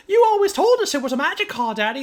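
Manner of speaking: put-on high, fast, loud childish whine; whiny kid voice. Quoting the kids in the film.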